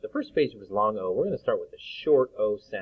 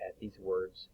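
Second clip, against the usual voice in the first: usual speaking voice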